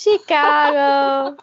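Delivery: mock Chicago accent. Echoing a friend who names the city, in a slow mock Chicago accent. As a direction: drag the word out like a Chicagoan.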